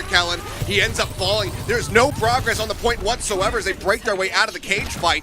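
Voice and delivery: commentary climbing with the action; live play-by-play. Real, not acted. Caster following the cage fight, building play-by-play.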